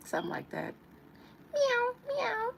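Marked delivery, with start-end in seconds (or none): 1.5-2.5 very high, loud squeaky meow; playful kitty meow; cat meow. Pretend. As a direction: mew like a cute kitten. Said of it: "I'm a cute little kitty".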